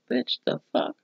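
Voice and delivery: hushed, breathy bitch the fuck; whispered disbelief. Retold or imitated. Viewer retelling a horror scene reacts to the ghost, whispering in disbelief. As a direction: hiss it under your breath in shock. Own reaction to Sally's line.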